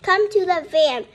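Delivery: loud urgent call. A narrator's reading. Grandpa calls Clown to the van, loud. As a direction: call him over to the van.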